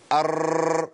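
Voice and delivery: أر with the r trilled many times over. Shown as a demonstration. Teacher imitating those who trill the r, over-rolled. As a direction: roll the أر long like the takrir camp does. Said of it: "في ناس بتقول كده".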